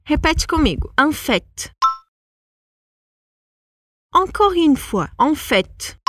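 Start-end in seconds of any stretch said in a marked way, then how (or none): 1.0-1.7 En fait with the final T clearly pronounced, fètt; final T sounded. Shown as a demonstration. Teacher has students repeat, T pronounced at the end. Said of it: "a gente pronuncia esse T no final". 5.2-6.0 En fait with the final T clearly pronounced, fètt; final T sounded. Shown as a demonstration. Teacher has students repeat, T pronounced at the end. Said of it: "a gente pronuncia esse T no final".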